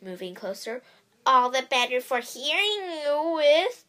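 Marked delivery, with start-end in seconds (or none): 1.2-3.8 loud, grinning fake granny; toothy grinning wolf. A narrator's reading. Wolf in Grandma's bed answers the girl, grinning. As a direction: grin through the words as the disguised wolf. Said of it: "with a toothy grin".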